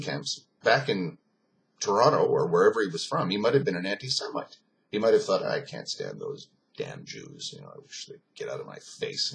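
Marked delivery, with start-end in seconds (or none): 5.4-9.3 low, soft, sour grumble; grumbled contempt. Retold or imitated. Allied soldier's prejudiced thought at home, grumbled contempt. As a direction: drop down into a private grumbling thought. Voicing a bigot's private thought.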